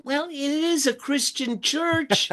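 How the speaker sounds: high, slow, teasing voice; high-pitched teasing retort; mock dry reply. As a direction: act out an obvious answer in a high voice. His own reply, high, slow.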